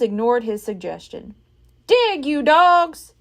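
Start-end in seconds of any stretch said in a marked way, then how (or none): 1.9-3.1 loud, slow drawn-out command; Captain Bones shouting at his men; shouted. A narrator's reading. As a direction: bellow each word at the crew. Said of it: "he shouted".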